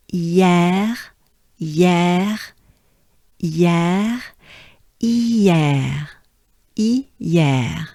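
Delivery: "hier" with rising end; French hier. Shown as a demonstration. Teacher drilling the word hier. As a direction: say "hier" as one glide. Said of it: "a very important sound in French".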